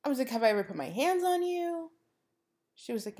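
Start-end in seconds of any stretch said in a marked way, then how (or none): 0.3-1.9 pointed question pitched higher; indignant demand. Retold or imitated. To the roommate who claimed to be scared, high indignant challenge. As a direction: drive the point home, incredulous. Retold confrontation with her roommate.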